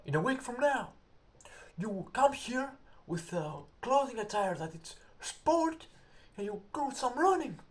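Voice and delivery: high, loud mock drill sergeant orders. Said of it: "he says".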